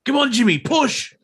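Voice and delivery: high-pitched mock cheering on; mock urging. Co-host jokes as the friend egging Jimmy on, mock urging.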